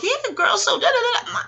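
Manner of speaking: high, laughing gossip voice; mock girl talk. Imitating mom's friends gossiping, high and laughing. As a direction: chatter it like giddy old friends. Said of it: "went right back to girl talk".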